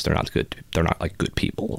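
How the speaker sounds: hushed gossip whisper. Real, not acted. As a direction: whisper it, not for others' ears. Gossip about soccer team.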